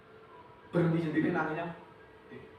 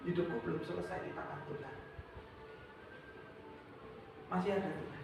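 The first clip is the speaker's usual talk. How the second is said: much softer, quiet and sure; soft declaration; 13 dB quieter, faster than usual. Shown as a demonstration. Preacher leads hurting people to say it softly. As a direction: say it softly to yourself.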